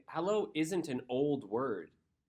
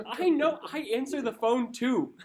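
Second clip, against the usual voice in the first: very high, loud mock indignation; exasperated outburst; 11 semitones higher, 10 dB louder than usual. Retelling a comeback to a partner who explained phones, comic exasperation. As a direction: blurt it out in comic exasperation, far above usual pitch.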